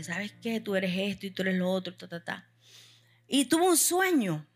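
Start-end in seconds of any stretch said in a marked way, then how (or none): 0.0-0.6 soft, much quieter tearful complaint; a hushed, wounded plea to God; tearful prayer. Retold or imitated. Preacher retelling her tearful night prayer to God, soft and wounded. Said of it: "lloré y le dije". 0.6-2.5 softer and softer, tearful reproach; tearful prayer. Retold or imitated. Preacher retelling crying to God all night, fading to a hush. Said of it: "lloré y le dije".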